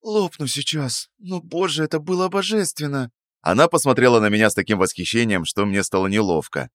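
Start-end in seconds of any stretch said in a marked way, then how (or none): 0.0-3.1 sated and blissful; full young woman. Acted. Narrator voices Sveta.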